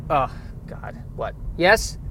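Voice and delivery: ordinary talk, nothing marked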